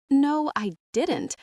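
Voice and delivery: usual speaking voice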